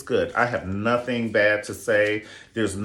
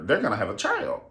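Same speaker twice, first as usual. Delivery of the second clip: usual speaking voice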